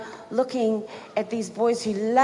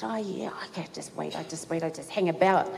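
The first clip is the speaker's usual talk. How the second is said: much softer, lower murmur; hushed aside; 5 semitones lower, 7 dB quieter than usual. Retold or imitated. Girl hiding her secret from Alan, hushed to herself. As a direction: keep it hidden, barely voiced.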